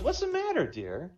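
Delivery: a high, sweet wife's voice. Acted. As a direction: pitch it up as the worried wife. Wife voiced in a joke.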